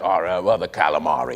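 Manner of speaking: put-on thick, mumbled Cockney accent. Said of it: "impression of an accent".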